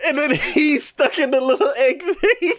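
laughing while describing the punchline. Real, not acted. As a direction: giggle the words out. Laughing retelling.

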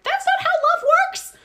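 very high, loud indignant cry; shrieked mock indignation; mock outraged shout. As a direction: yell it in hammed-up outrage. Brothers' reaction, acted.

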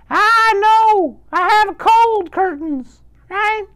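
loud, very high falsetto granny; wolf posing as grandmother. Acted. Wolf fooling the grandson, in a shrieky falsetto disguise. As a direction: squeal it out as a fake grandmother. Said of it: "he changed his voice".

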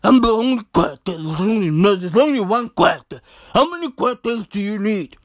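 low put-on politician's voice, self-important; Gwede Mantashe. Comedian as minister boasting he is unique, deep mimicking voice. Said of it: "He talks like that".

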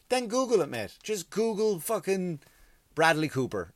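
high-pitched, exasperated comeback; exasperated retort. Acted. Comedian tells picture-taking fan to search online, exasperated retort. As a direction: tell the fan off, voice pushed up high.

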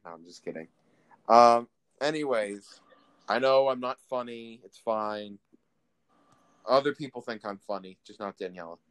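ordinary talk, nothing marked

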